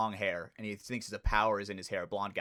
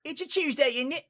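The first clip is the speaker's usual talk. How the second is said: much higher, louder hammed-up British accent; mock British accent; 6 dB louder than usual. Podcaster jokes about being British, in a hammy British accent. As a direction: camp up a silly British accent, pitched way up. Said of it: "Imagine if I was British".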